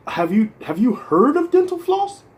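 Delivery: high sarcastic lecturing dentist; imitating a dentist lecturing a patient, high and sarcastic; mocking dentist. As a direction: jump way up in pitch to mock the dentist. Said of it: "they give you a lecture".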